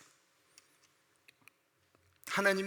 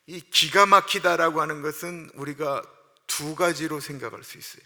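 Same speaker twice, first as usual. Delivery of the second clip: ordinary talk, nothing marked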